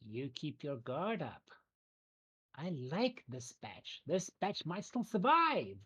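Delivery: slow, deliberate goblin chief voice; slow goblin boss. Acted. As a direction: drag each word out, ominous. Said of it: "she looks at you and goes".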